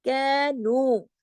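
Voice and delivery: कैनू very slow and thin, not opened wide; light letter demo. Shown as a demonstration. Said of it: "मुंह बड़ा सा खोल के नहीं पढ़ना है".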